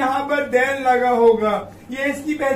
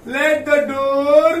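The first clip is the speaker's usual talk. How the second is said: very slow, drawn-out words; dictation pace; 4 dB louder, much slower than usual. Shown as a demonstration. Teacher to children spelling out the passive rule, very slowly. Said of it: "लिखेंगे let".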